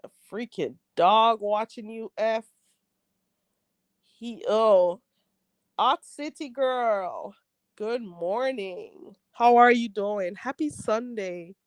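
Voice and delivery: ordinary talk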